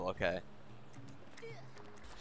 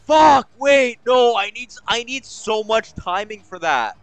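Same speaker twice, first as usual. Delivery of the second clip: loud, high-pitched burst of alarm; flustered outburst; 8 semitones higher, 10 dB louder than usual. Real, not acted. Gamer protesting the timing needed, flustered. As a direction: blurt it out in a fluster. Panicking at a hard section.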